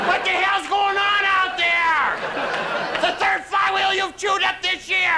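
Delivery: high, slow furious yell from the bedroom; dad yelling. Acted. Dad roaring at mom from inside the house. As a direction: bellow like a roused old father. Dad yells from his coma.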